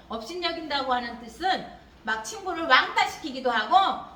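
ordinary delivery

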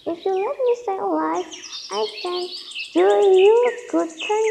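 high, pleading little voice; frightened mouse. Acted. Tiny mouse promising the lion a good turn, high pleading. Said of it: "said the frightened mouse".

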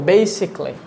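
basically as three beats, "BAY-sik-lee"; crisp "bay-sik-lee" for basically; British-style pronunciation. Shown as a demonstration. Teacher drilling learners, "bay-sik-lee". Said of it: "কি বলবো?".

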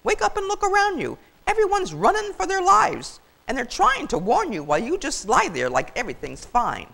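high, loud frantic scolding; loud, swooping exasperated tirade; berating. Acted. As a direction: harangue him to wake up. Goose berates turkey.

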